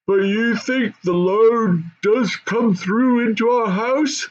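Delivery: high, slow goblin mother. Acted. Goblin mother asking her husband about the load, high and slow. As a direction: raise the voice high and draw it out like a fussy goblin mother. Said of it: "said the first voice".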